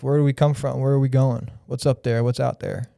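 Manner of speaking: ordinary delivery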